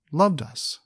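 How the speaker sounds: usual speaking voice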